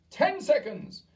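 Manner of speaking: high, loud witch cry. A narrator's reading. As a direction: bark the count gleefully.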